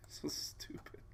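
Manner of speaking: soft, hushed grumble; whispered aside. Real, not acted. Grumbling about the puzzle under his breath, a whisper. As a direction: whisper it to yourself. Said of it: "whispered aside".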